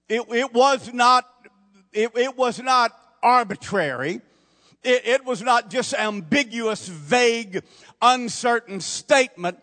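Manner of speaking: loud, high, hammering each not; full-force preaching. Acted. Preacher to the congregation, hammering each phrase loud and high. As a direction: preach it hard, building.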